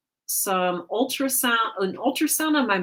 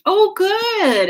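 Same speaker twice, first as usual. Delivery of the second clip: ordinary talk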